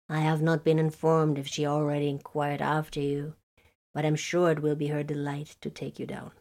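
lightly voiced stiff navigator; a hint of a formal old navigator. A narrator's reading. Navigator character voice.

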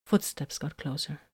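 plain reading voice

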